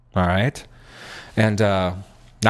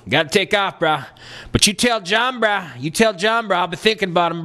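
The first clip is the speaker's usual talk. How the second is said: high laid-back bro voice; high drawling bro, bruh on everything; 6 semitones higher than usual. Retold or imitated. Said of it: "he called up and he said".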